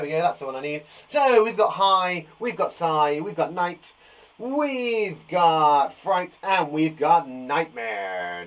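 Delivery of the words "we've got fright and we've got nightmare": stretched out slow and loud; slow, drawn-out spooky reveal; dramatic slow reading. Shown as a demonstration. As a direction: draw each spooky word out slowly.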